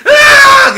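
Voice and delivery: huge high loud scream; full scream. Shown as a demonstration. Psychologist demonstrating the possessed-sounding scream. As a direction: let every buried anger burst out. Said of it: "Jadi, ... gitu".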